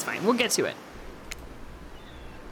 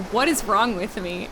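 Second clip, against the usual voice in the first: ordinary delivery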